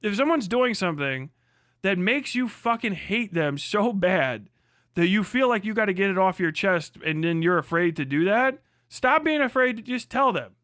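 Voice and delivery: ordinary talk, nothing marked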